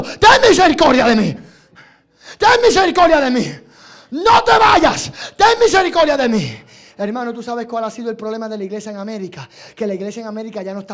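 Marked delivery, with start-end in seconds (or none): 0.0-6.6 high, loud desperate plea; desperate shouting. Acted. Desperate woman calling after Jesus as he walks away, shouting. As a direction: cry out for mercy at full voice. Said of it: "una mujer desesperada, gritando".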